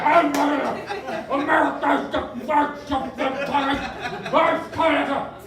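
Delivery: loud, lazy mush-mouthed American parody; garbled American accent. Retold or imitated. Mocking how Americans treat English, garbled American accent. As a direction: mangle the words in exaggerated American. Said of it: "it's just garbled, lazy crap".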